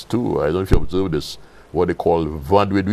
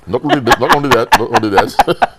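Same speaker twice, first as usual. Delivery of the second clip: louder and higher with each "not only that"; building emphasis; 5 semitones higher, 7 dB louder than usual. Real, not acted. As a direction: hammer the phrase home, louder each time. Repeated emphatic phrase.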